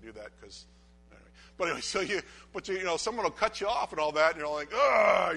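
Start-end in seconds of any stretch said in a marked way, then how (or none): 4.7-5.4 loud high ugh of a fed-up driver; hammed-up exasperated ugh in traffic; mock frustrated groan. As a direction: groan out mock road rage. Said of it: "you get mad".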